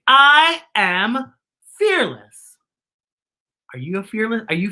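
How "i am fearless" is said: louder, higher heroic declaration; bold hero cry. Acted. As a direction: declare it like a brave little hero. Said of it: "he's able to say".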